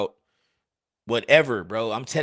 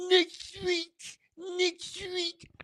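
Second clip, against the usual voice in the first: squeaky mock; 7 dB quieter, much slower than usual. Redoing the phrase, high squeak.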